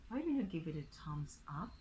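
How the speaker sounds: ordinary talk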